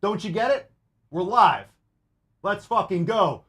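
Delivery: high, pumped-up hype; hyped shout. Real, not acted. Host hyping his live audience, pumped and high. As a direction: fire up the live crowd. Hyped podcast opening to camera.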